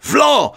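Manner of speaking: high, punched slap sound effect; slap noise. A narrator's reading. Narrator mimics a slap on the lecturer, sharp high exclamation.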